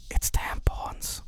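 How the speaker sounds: slow breathy whisper in a cinema. Retold or imitated. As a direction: lean over and whisper it. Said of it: "kept whispered to that".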